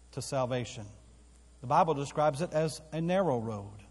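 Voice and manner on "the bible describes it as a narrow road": normal talking voice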